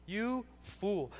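a stern judgment coming down; harsh rebuke. Shown as a demonstration. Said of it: "It's a harsh word".

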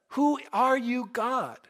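high, slow, wondering question; Saul questioning God. Retold or imitated. Saul asks the voice who it is, high and questioning. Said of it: "and he says".

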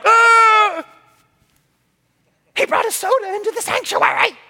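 high, prissy gasp of outrage; mock scandalized churchgoer. Pastor mocking members shocked by a soda, high scandalized voice. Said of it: "some of you right now are like".

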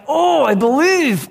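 high, drawn-out anguished cry; hammed-up desperate wail, stretching the words. Desperate father crying out to Jesus, acted anguished cry. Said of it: "he's, he's crying".

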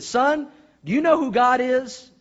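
high, loud, drawn-out questioning; stern pastor. Acted. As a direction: confront the boy slowly and loudly. Pastor questions boy in joke.

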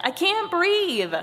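high, slow, drawn-out storybook plea; gasped. A narrator's reading. Head is squashed by Body, gasped plea. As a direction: plead as if pinned under a weight. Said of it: "gasped Head".

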